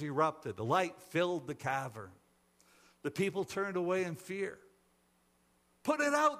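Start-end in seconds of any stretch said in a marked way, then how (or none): 5.8-6.4 high, loud pained cry; panicked crowd shout. A narrator's reading. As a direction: cry out in alarm, pleading for relief. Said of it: "they cried".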